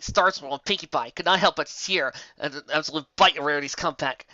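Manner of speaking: plain reading voice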